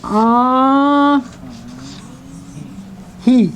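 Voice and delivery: "Uh, he" split in two, with a long "uh" and a separate "he"; syllable by syllable. Shown as a demonstration. Teacher breaking down the word, syllable by syllable. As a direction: draw out "Uh", pause, then say "he". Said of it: "It makes a big difference in that".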